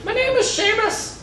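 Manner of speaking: put-on Texan Irish accent. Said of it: "my Texan Irish accent".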